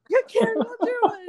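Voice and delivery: high, loud fan squeal; starstruck. Retold or imitated. Cheerleader acting out meeting the singer, starstruck. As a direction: gush at a celebrity, pitch way up.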